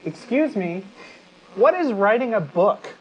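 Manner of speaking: ordinary delivery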